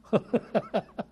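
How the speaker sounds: short bright laugh. Real, not acted. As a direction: laugh at your own promise. Pastor laughs promising a prize. (短促的笑声)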